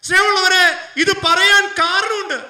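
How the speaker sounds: loud, high, slow pulpit shout; impassioned preaching. Acted. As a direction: preach the appeal with full force. Preaching the closing appeal.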